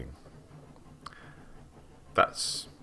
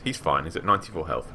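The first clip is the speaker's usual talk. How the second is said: ordinary talk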